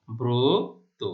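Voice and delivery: ব্রত as "broto", first syllable loud and drawn out; closed o in ব্রত. Shown as a demonstration. Teacher demonstrating the rule, ব্রত as a loud, long "bro-to". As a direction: stretch and lift the "bro" of ব্রত. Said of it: "ব এর রফলা ওকার".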